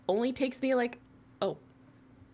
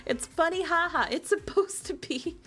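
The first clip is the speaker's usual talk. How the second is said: high-pitched flustered protest; high defensive; 9 semitones higher than usual. Real, not acted. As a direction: insist it's only funny, high-pitched. Defending the egg as only funny.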